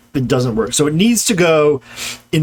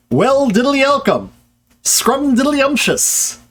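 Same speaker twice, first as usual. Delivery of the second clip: chirpy cartoon voice, much higher; Ned Flanders; 9 semitones higher than usual. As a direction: bounce through it like the cheery cartoon neighbour. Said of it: "this is what Ned Flanders does".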